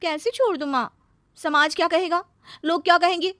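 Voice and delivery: high, anxious daughter's voice; plaintive, high-pitched worry; distressed protest. Acted. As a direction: protest helplessly to your mother. Daughter protests to her mother.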